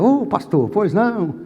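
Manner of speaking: acted deferential "at your service" tone; respectful changed voice. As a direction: put on proper manners for the pastor. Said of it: "Com o pastor eu não posso falar de qualquer maneira".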